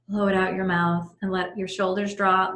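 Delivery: normal talking voice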